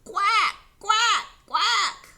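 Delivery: loud, high, sharp quacks; high-pitched duck calls; calling ducks. Pretend. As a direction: quack loudly like a flock calling out. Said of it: "they all called".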